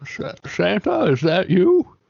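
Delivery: high, stuttering, wondering question; amazed recognition. Acted. Player spots a large bearded man, amazed recognition. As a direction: falter on the name, then lift it in wonder.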